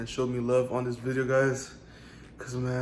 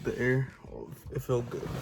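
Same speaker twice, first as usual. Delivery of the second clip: normal talking voice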